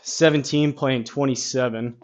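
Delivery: normal talking voice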